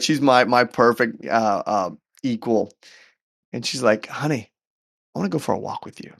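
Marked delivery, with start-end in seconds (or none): 4.1-6.1 imitating wife, softly; gently ask, much softer; soft request. Wife asks husband to join his walk, soft request.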